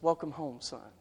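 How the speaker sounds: hushed, low fatherly welcome; very soft, low and tender. Acted. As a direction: murmur it softly to a returning child. Father tenderly welcomes returning son.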